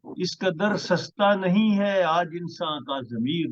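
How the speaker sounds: measured, stately poetry recital; ghazal recitation. Acted. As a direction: recite each line with weight. Poet recites new ghazal.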